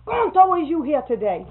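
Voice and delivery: teacher losing patience, slipping into dialect; exasperated roll call. Retold or imitated. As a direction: bellow the name out over the rostrum. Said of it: "just like that".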